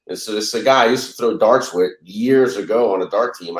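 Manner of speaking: usual speaking voice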